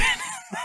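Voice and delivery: quick unvoiced laugh; short airy burst of laughter; breathy laugh. Real, not acted. Laughs after her own quote.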